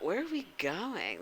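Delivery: ordinary delivery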